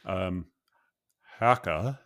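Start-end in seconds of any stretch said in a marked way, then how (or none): 1.4-2.0 "Haka" pushed out louder, a second try at the celebrity voice; trying a bolder take on the one-word impression; louder impression retry. Co-host guesses the celebrity from a louder retry of the impression. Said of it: "you're never gonna get it based on that".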